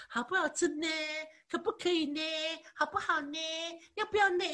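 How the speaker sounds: mock-cute Taiwanese girl asking sweetly; coquettish Taiwanese speech. Shown as a demonstration. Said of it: "台湾人他们讲话很喜欢加上那个".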